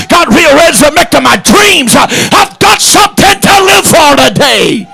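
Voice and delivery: full-throated revival sermon, every phrase punched out; rhythmic shouted church preaching; fiery preaching. Acted. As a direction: preach it to the back pew with fire. Preaching at full force again.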